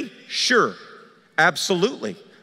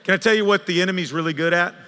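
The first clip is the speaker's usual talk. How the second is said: ordinary talk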